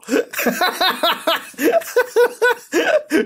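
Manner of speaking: high, helpless laughing fit; burst of laughter. Real, not acted. Viewer cracking up at a comment, laughing hard.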